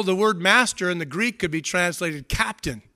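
ordinary talk

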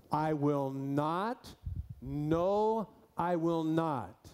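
slow "I will not, no, I will not." with each "not" leaned on hard; emphatic double negative. Shown as a demonstration. Preacher reading the Greek aloud to his church, slow and emphatic. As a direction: spell out "I will not" slowly, stressing "not" both times. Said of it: "in the Greek it would read like this".

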